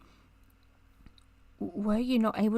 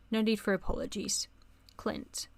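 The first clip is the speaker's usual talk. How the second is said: usual speaking voice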